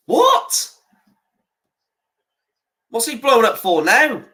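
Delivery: very high, rising, incredulous what; stunned by another whistle; shocked disbelief. Real, not acted. Reacting to a referee's whistle.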